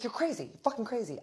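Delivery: high-pitched imitation of a rattled man; mock-frantic accusing voice; alarmed accusation. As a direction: fling it out high and appalled. Acted man accusing her.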